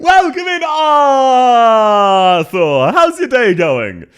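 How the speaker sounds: high, loud called-out welcome; loud greeting shout. Real, not acted. Greeting a new chatter, shouting high and loud. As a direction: call out the welcome across the room.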